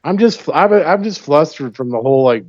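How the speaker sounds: normal talking voice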